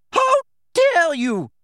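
very high, loud, slow stretched shout; furious shout. A narrator's reading. McGonagall reaching the attackers, very high furious shout. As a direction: scream it with full outrage. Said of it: "the figure shouted".

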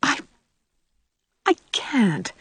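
a touch of misery, faltering; halting, understated refusal; miserable. A narrator's reading. Anne to Gilbert, refusing his proposal, mildly miserable. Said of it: "said Anne miserably".

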